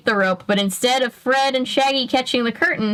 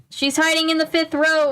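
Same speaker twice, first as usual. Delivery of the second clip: calling out; 7 semitones higher than usual. Acted. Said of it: "Velma called".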